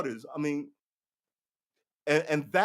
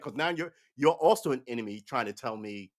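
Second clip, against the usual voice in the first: normal talking voice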